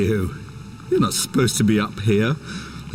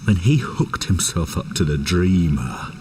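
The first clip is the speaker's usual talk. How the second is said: ordinary delivery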